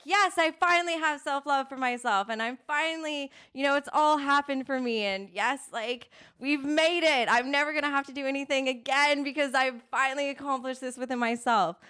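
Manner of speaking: high, gushing triumph; mock elation. Coach voicing someone who woke up with self-love, mock elated.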